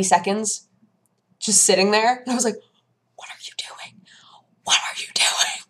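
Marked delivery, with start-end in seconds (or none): 3.2-5.6 hissed whisper of disbelief; exasperated whisper. Retold or imitated. At the stalling opponent, exasperated whisper. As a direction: whisper it through frustration.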